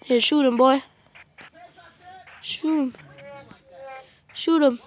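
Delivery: high, loud urgent commands; excited urging. Real, not acted. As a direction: shout it at your teammate. Urging teammate during game fight.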